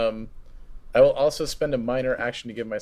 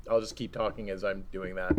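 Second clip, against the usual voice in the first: ordinary delivery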